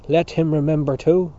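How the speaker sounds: loud, indignant retort; crying out. A narrator's reading. Mister Casey to Dante across the table, crying out. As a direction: throw it loudly across the room. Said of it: "cried Mister Casey to her".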